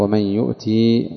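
يؤتي ends in a long held "ii"; stop on the ya. Shown as a demonstration. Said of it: "وقف عليها بالياء". (الوقف على يؤتي بالياء)

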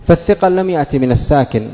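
ordinary talk, nothing marked

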